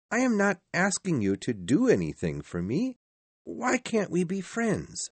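high-pitched appeal to an uncle; pleading nephew. A narrator's reading. Narrator voices the nephew.

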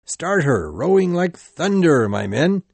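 loud rallying command to the rowers; urging his rowers on with a loud shout; shouted order. Acted. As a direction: bellow it across the boat. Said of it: "he ordered".

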